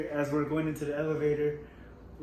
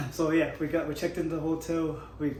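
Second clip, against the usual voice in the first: ordinary talk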